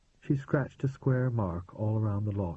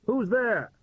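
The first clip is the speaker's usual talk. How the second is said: a high, louder call through the door; 12 semitones higher, 5 dB louder than usual. A narrator's reading. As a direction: call out to find who's inside. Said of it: "A voice called".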